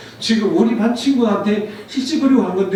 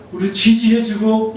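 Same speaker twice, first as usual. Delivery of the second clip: usual speaking voice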